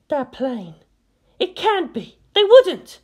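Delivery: from wary to alarmed; rising alarm. A narrator's reading.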